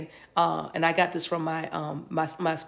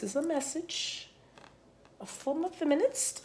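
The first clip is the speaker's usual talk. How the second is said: teasing aside; 9 semitones higher, 7 dB quieter than usual. Acted. A pointed aside to feminists.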